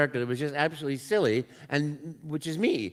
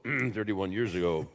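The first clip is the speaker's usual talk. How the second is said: lower repeat; 7 semitones lower, 3 dB quieter, slower than usual. Shown as a demonstration.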